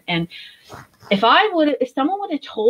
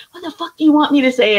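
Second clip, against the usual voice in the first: fast, loud, pitched-up retold blow-up; retold outburst; 7 semitones higher, 4 dB louder, faster than usual. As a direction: relive the moment she exploded at him. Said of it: "I just went off on him".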